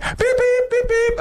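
very high, meek beeping; spoken horn beeps. Retold or imitated. Mother voicing her own honk at drivers, meek beeps. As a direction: imitate a timid little car horn. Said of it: "she would say that with her vo".

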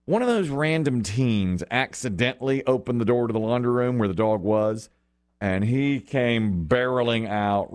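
usual speaking voice

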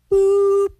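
high, loud boop; imitated button press. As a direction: mimic a buzzer button, high and sudden. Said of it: "So I'm like".

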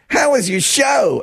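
high, drawn-out cheery imitation; mock an overly chipper colleague; slow, exaggerated chipper question. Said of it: "He was all chipper".

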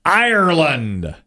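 high, drawn-out announcer call; game show reveal call. Real, not acted. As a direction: stretch it out for the big reveal. Host calls for the board reveal.

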